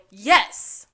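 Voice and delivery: loud eager chorus yes; a hammed-up unison yes; mock eager yes. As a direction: shout it together, too keen. All three answer in unison.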